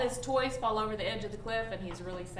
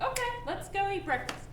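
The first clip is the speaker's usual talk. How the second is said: bright and recovered; 10 semitones higher than usual. Retold or imitated. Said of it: "he's like".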